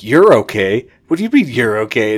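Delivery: incredulous outburst. Retold or imitated. The guy snapping back loudly at the man. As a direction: throw his words back at him, loud. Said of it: "he's like".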